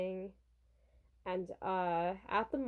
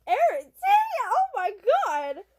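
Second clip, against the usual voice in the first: high, loud acted shriek; hammed-up starstruck scream; excited fan yell; 13 semitones higher, 10 dB louder than usual. Shop owner recognizing the pop star, loud excited yell. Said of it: "the shop owner comes to yell at them".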